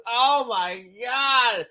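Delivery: drawn-out dreamy "Oh my God"; breathless mock delight; slow swooning gasp. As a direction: swoon over him, slowly. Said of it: "she's sitting there going".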